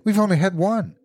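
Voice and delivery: high, urgent reply; rapid, eager. A narrator's reading. Wife explaining the remaining wishes to her husband, eager. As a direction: press the point eagerly. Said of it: "she replied rapidly".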